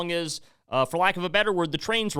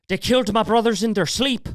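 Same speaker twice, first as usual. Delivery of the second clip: put-on Irish accent take; 8 semitones higher, 5 dB louder than usual. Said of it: "Can't do a very good Irish accent".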